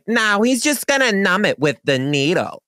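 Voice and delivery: high-pitched mock Staten Island nurse; imitated Staten Island accent. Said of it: "this Staten Island dermatologist office".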